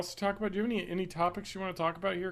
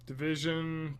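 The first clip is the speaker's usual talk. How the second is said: ordinary talk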